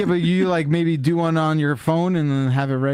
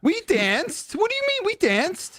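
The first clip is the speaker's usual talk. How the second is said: incredulous. Real, not acted. Incredulous about dancing.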